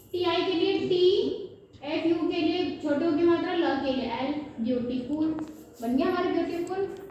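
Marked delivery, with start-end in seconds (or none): none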